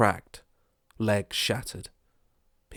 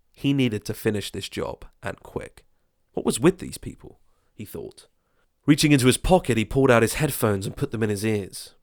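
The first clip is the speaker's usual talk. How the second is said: usual speaking voice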